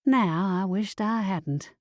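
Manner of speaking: usual speaking voice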